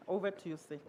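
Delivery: usual speaking voice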